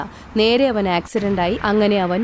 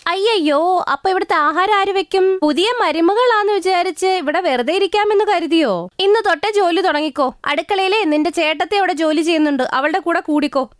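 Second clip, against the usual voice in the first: higher-pitched bossy mother-in-law voice; stern mother-in-law; 8 semitones higher than usual. Acted. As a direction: lay down the house rules like a strict mother-in-law. Mother-in-law orders bride.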